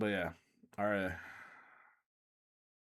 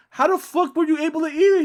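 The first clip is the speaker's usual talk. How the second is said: high, loud disbelief; disbelieving shout; 10 dB louder than usual. Retold or imitated. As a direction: yell it in disbelief. Disbelief, screaming.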